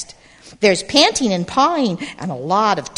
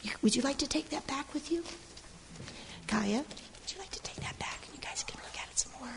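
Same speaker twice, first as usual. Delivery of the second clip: whispering; 7 semitones higher, 17 dB quieter, much faster than usual. Real, not acted. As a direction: whisper quickly and softly. Whispering to children as they leave.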